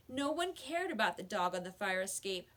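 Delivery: plain reading voice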